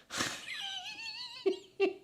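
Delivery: high helpless laugh bursting out; sudden breathless giggle; burst of laughter. Real, not acted. Laughing about his son.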